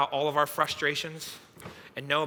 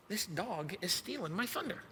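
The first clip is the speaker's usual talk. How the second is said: quiet, high-pitched grievance; jealous grumble; 4 semitones higher, 10 dB quieter than usual. Retold or imitated. Husband sidelined by new puppy, jealous soft grumble.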